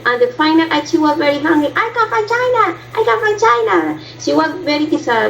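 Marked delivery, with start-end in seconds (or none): 1.8-4.0 much higher, squeaky mimicking voice; high mimic of the girl. Student mimics the hungry girl's repeated answer, high pitched. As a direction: pitch way up to mock the girl's line.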